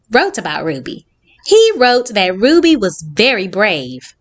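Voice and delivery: ordinary delivery, nothing marked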